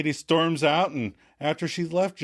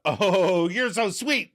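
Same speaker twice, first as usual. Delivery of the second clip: spat out; 7 semitones higher, much slower than usual. Retold or imitated. Host voicing Rachel snapping back at Katie, mock sweet. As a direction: spit the compliment back with venom. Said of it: "Rachel spits out".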